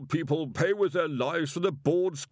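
fiery public denunciation; indignant orator. Acted. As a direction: rail against the board. Angry speech read as omniscope voice.

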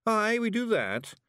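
high, firm Scots voice; proud and stubborn; stout Feegle. A narrator's reading. Rob Anybody insists to Miss Level, high and firm in Scots. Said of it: "said Rob Anybody stoutly".

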